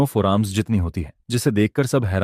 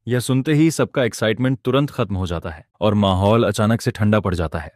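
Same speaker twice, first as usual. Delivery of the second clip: ordinary delivery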